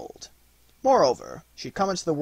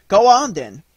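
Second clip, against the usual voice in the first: loud, higher, urging voice; eager cry; 6 semitones higher, 9 dB louder than usual. A narrator's reading. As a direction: clamour like children wanting a story. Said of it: "cried the children".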